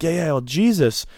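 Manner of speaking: high, earnest preaching; hammed-up high know-it-all; mocking preachy kid. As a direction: pitch up and explain it like gospel. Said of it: "And he's like".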